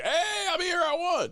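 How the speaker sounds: high, drawn-out triumphant call; mock gloating. Winner announcing himself to the losers, gloating.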